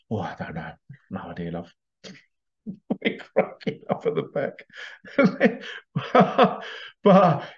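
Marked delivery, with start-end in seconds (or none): 0.1-1.7 evasive husband. Retold or imitated. Husband dodges wife's question.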